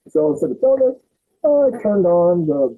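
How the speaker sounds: usual speaking voice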